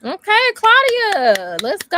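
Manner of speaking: high, slow, loud drawn-out call of the winner; excited announcement. Real, not acted. Cohost hyping up the winner she is announcing, excited slow call. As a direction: stretch the name out like a game-show host.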